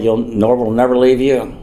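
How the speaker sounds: ordinary talk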